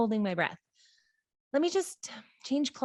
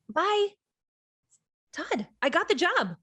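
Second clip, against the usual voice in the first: high and louder, thrilled news; excited; 8 semitones higher, 4 dB louder than usual. Acted. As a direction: burst out with the good news. Excited after getting job.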